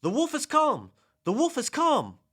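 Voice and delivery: high, loud cry of alarm; yelling. A narrator's reading. Shepherd boy raising a false alarm to the village, high yell. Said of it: "and yelled".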